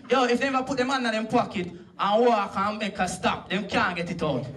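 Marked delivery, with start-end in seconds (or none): none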